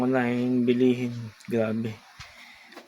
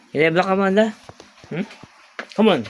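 high, louder sing-song to a pet; 7 semitones higher, 5 dB louder, much faster than usual. Real, not acted. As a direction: coax the cat sweetly. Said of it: "say hello, baby". (malambing na boses sa pusa)

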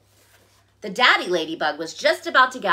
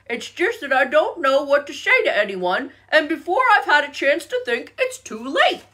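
high, put-on bear voice, climbing; shy bear voice; 7 semitones higher, 4 dB louder than usual. A narrator's reading. Shy bear confiding in frog, high-pitched. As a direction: explain yourself as a bashful bear.

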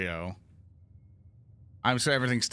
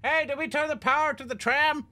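high, raised call; 14 semitones higher than usual. Acted. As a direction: shout across to a friend.

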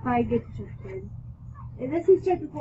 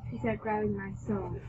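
ordinary delivery

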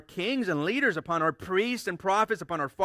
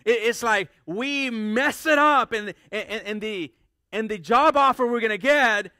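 raised and loud, with an indignant edge; emphatic preaching; 5 semitones higher, 7 dB louder than usual. Acted. Preacher illustrating a lost blessing to the church, loud and raised. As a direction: build the example up with indignation.

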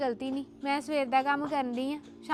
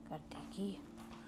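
slow, unvoiced grumble under the breath; muttered aside; 9 semitones lower, 17 dB quieter, much slower than usual. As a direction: mutter it to yourself, barely audible.

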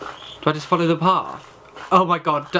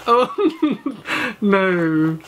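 nervous laughing; 2 semitones higher than usual. Real, not acted. Frightened player reacting to the game, nervous laughing. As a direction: laugh nervously, then sink into a drawn-out no.

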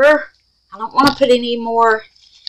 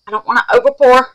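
usual speaking voice

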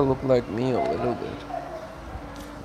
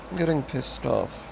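quietly fed up; 7 dB quieter than usual. Real, not acted. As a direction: say it softly, holding the anger in. Low take before shouted repeat.

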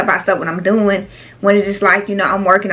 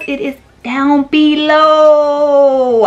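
very slow, stretched, raised pitch; playful drawn-out emphasis; 4 semitones higher, 4 dB louder, much slower than usual. Real, not acted. Vlogger hammering home where the links are, playfully drawn out. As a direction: hold BELOW out long, sing-song. Exaggerated repeat of the link reminder.

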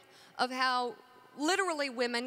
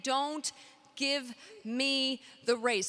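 "Don't give me the raise" slowly, word by word; spelled-out mock request; much slower than usual. Shown as a demonstration. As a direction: pause after "Don't" and space out "give me the raise". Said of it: "you're literally saying".

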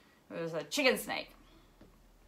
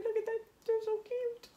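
cooing; 14 semitones higher than usual. Real, not acted. Cooing over cute bush baby.

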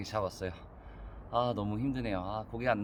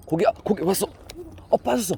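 excited; 10 semitones higher, 7 dB louder, faster than usual. Real, not acted. Fish on the line.

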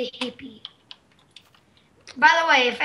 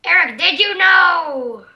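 louder and slower, insisting; loud insistent; 3 semitones higher, 7 dB louder, slower than usual. Real, not acted. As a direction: call out to Eric, demanding. Repeating question louder.

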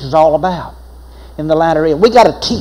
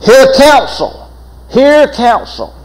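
preaching emphasis; 7 semitones higher, 3 dB louder, much slower than usual. Acted. Preacher repeats the verse.

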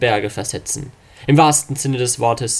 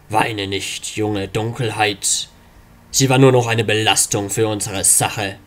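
low, slow villain voice; low dark father; 3 semitones lower, slower than usual. Acted. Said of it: "evil father voiced low".

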